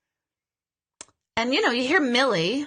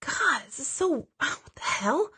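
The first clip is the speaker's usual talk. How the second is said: baffled aside; 4 semitones higher, 5 dB quieter than usual. Retold or imitated. Medium thinking during a confusing reading, soft and bewildered. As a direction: mutter it under a sigh, bewildered. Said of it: "I'm like".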